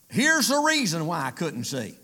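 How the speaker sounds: ordinary delivery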